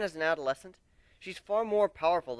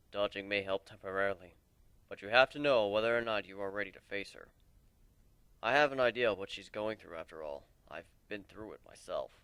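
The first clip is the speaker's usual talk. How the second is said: put-on a lower, weary old warrior voice; lightly lowered voice of a weary mentor; lower voiced warrior; 6 semitones lower than usual. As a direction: speak as the old lone warrior, regretful. Old warrior Aoi speaking.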